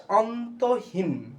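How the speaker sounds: very slow, stretched out; drawn-out word. Shown as a demonstration. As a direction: stretch the word like reading it aloud. Reading out a student's answer.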